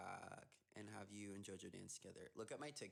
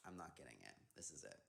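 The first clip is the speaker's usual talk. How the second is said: resigned; 7 semitones lower, 4 dB quieter than usual. Retold or imitated. Retold resignation after cast announced.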